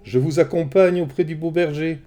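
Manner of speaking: high, breezy charm. Acted. Hermès charms the goddesses, high and breezy. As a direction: sweep them along with charm. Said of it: "d'un ton charmeur".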